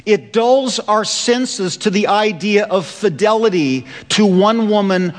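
ordinary talk

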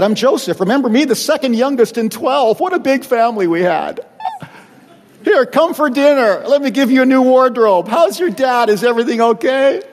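high, breezy host voice, fast; gushing like a cheery host inviting them to dinner; overly friendly Joseph. Pretend. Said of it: "Joseph could have said".

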